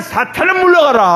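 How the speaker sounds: high, loud commanding rebuke; stern rebuke. Retold or imitated. Preacher voicing Jesus rebuking Satan, loud stern command.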